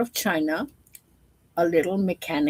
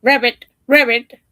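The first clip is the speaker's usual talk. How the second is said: frog croak; 4 semitones higher, 8 dB louder than usual. Acted.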